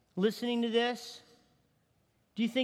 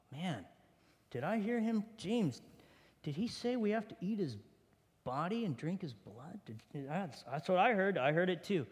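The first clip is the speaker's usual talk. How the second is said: low, much softer, disbelieving murmur; hushed aside; 5 semitones lower, 7 dB quieter than usual. Acted. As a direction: lean in to James and murmur in shock. Disciples whispering to each other.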